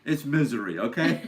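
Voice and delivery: loud, high playful outburst; mock insistence. Settling the Missouri argument, loud mock insistence. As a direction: butt in loudly as if settling it.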